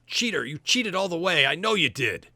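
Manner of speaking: high and loud, still raging; angry accusation. Acted. Todd still yelling at the archer he lost to, angry. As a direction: keep hurling the accusation. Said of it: "He's like".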